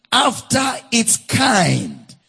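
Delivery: slow and drawn out, each word weighted; preacher hammering the key phrase home; emphatic phrase. Shown as a demonstration. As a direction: lean hard on after its kind. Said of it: "anywhere you see emphasis in scripture".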